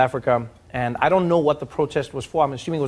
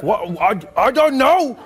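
flustered cop; 8 semitones higher, 4 dB louder, slower than usual. Retold or imitated. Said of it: "he's like".

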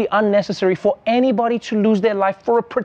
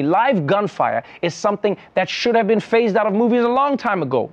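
ordinary talk

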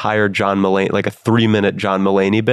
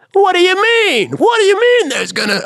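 shrill squeal, much higher and louder; Mulaney's other voices; 4 dB louder than usual. Retold or imitated. Mimicking a character from the comedian's bits, squealing loudly. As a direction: burst into a squeaky, outraged character voice. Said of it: "He does other voices too".

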